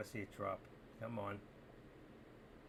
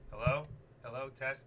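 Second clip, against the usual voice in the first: normal talking voice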